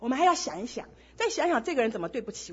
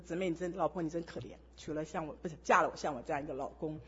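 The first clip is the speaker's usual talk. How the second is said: low, quiet, humble murmur; soft apologetic husband; 7 semitones lower, 8 dB quieter than usual. Retold or imitated. Husband apologizing to his wife, very soft and low. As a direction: say sorry gently, taking the blame. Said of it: "他第一个反应就说".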